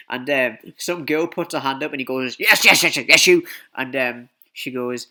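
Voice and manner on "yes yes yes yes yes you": loud, raised rapid-fire yeses; imitated instructor calling out; eager calling on someone. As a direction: pick out a raised hand, loudly. Instructor picks girl with hand up.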